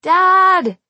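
high, loud yell; full-voiced, drawn-out shout. A narrator's reading. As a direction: yell desperately through a closed window. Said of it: "I yelled".

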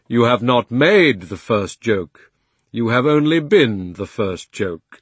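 slow, grand lion voice. Acted. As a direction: speak as a kindly great lion. Lion's reply.

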